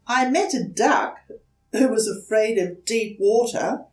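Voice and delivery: put-on high, hesitant small-child voice; young turtle politely asking a stranger for help; timid little turtle. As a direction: ask shyly, a little scared. Franklin reports.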